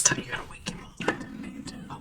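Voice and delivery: hushed, worried whisper. Real, not acted. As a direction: whisper so the pet stays asleep. Whispering near sleeping pet.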